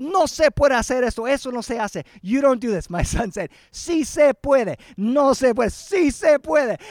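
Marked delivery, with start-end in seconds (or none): 0.0-2.9 louder, higher mock scolding; retold yelling. Brother forbidding his nephew the drum, mock yelling. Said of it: "he started yelling at my son". 3.7-6.8 loud, high, drawn-out mock arguing; acted squabble. Uncle and small boy bickering over a drum, acted squabble. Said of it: "they're going back and forth".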